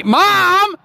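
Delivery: shrill-free loud shocked Mom!; shocked shout. Retold or imitated. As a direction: yell at your mom, scandalized. Child scolding mother in story.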